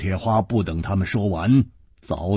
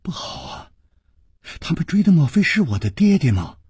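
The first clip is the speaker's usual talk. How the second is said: breathy gasp into higher, quick question; alarmed, sharp voice; 10 semitones higher than usual. A narrator's reading. Princess fears her father is being chased, alarmed and hurried. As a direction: start hushed, then rush higher in alarm. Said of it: "琵琶公主尖声说".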